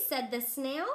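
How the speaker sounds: ordinary delivery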